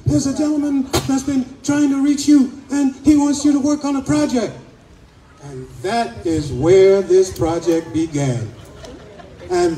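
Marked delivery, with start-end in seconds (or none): 0.1-4.5 high-pitched, eager quote of two excited ladies; worked-up, breathless-to-tell imitation. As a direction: imitate them gushing, pitch up throughout. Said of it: "they're all excited".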